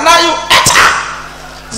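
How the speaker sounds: high, breathless, slow command; slow whispered order. Retold or imitated. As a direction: hiss the order out slowly. Robber orders old woman.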